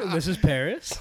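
laughing. Real, not acted. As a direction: joke through laughter. Joking amid group laughter.

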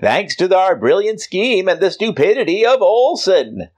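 high, loud triumphant villain; gloating alien villain. Acted. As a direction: crow over a fallen hero. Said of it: "they go".

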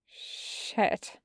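hushed curse under the breath; muted curse. A narrator's reading. Wash notices his bleeding side, cursing quietly. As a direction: let the word slip out very softly. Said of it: "he curses".

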